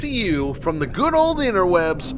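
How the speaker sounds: big show-opening announcer read; announcer intro. Acted. Announcer opening the show for viewers, hyped announcer read.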